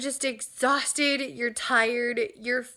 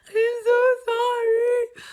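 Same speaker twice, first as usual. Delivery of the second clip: much higher, louder, slow tearful mock apology; apologizing to crying baby; 12 semitones higher, 7 dB louder, slower than usual. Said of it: "being like".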